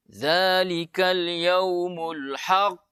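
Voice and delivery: slow, overdone recitation with a heavy bounce on "haqq"; exaggerated recitation. Shown as a demonstration. Teacher mocking an over-the-top recitation. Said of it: "tidak boleh lebay".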